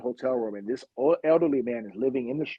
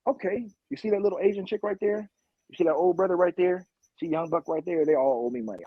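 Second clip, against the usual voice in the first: a higher, offhand hustler voice; drug dealer's orders; 6 semitones higher than usual. Retold or imitated. A drug dealer pointing out who owes him, high and offhand. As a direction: point out the marks casually.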